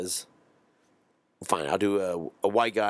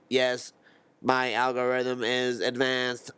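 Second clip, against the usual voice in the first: slow, higher monotone robot voice; robot podcast host; 4 semitones higher, slower than usual. Acted. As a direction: chat like two robots, slowly on one held note. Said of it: "who would the robot have on".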